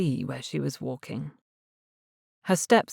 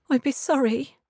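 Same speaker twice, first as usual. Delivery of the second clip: timid; 9 semitones higher than usual. A narrator's reading. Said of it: "she said in a timid voice".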